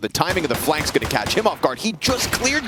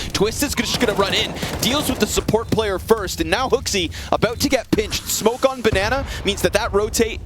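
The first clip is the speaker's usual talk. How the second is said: rising play-by-play hype; esports caster; 5 semitones higher than usual. Real, not acted. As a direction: call the round as it builds. Live play-by-play build.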